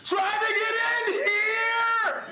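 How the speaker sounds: very high, loud, slow bellow; drunken yell. Retold or imitated. Drunk Lucas yelling into the intercom, slow bellow. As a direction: yell it at the top of your lungs. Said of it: "Lucas yells at the top of his lungs".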